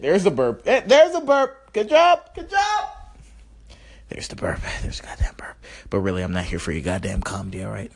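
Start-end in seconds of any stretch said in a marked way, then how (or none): 0.0-2.9 silly high burp cheer. Pretend. 4.1-5.6 breathy whisper to himself; whispered aside. Pretend. Whispering to the listeners about his burp. Mutters the burp line again.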